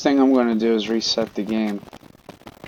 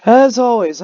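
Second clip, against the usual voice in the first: loud; 13 semitones higher, 12 dB louder than usual. Real, not acted. Loud outro greeting.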